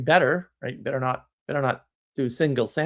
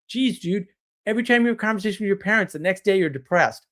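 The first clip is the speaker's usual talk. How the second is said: brisk retold advice; 5 semitones higher, faster than usual. Retelling advice to a client.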